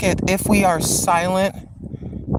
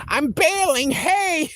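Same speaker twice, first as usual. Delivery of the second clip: put-on old man voice; 11 semitones higher, slower than usual. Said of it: "and you're like".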